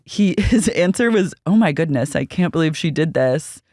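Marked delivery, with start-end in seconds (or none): none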